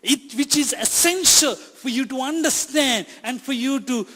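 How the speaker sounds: fervent preacher building to a climax; building sermon. Acted. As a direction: drive it home to the congregation, growing stronger.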